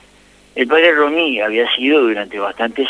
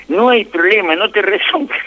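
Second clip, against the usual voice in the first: put-on higher, delighted child's voice; happy child; 9 semitones higher than usual. A relieved boy tells the waiting kids, higher and delighted. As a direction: announce the good news joyfully.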